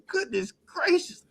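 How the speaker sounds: high, slow incredulous moan; mock outrage. Real, not acted. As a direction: stretch it out in disbelief. Mock outrage at the trade cost.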